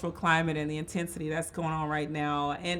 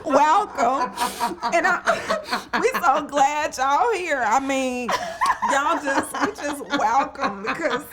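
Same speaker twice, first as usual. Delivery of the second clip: higher, choked-up mock welcome; Brandis; 6 semitones higher, slower than usual. Co-host teasing her friend while welcoming listeners, mock-tearful. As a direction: play an overcome host, hammed up. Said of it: "let me just do my Brandis".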